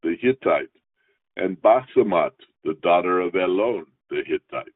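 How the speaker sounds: measured, formal reading of the Torah text; scripture reading. Acted. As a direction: read each verse out steadily like in synagogue.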